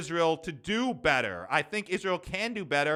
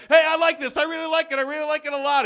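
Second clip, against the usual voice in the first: put-on high, loud, excited kid voice; hammed-up child gushing; imitating the corn kid; 13 semitones higher, 11 dB louder, faster than usual. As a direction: squeal it like an overeager little kid. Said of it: "be like".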